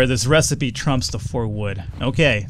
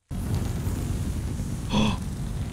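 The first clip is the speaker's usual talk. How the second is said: gasp; 8 semitones higher, 8 dB quieter than usual. Real, not acted. Catches fire in game.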